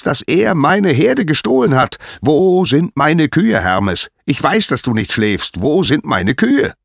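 furious god demanding answers; angry shouting. Acted. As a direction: rage building to high, loud shouts. Apollo rages at Hermes.

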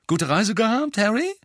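high, loud friendly call. Acted. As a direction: call across the kitchen. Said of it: "rief Bill".

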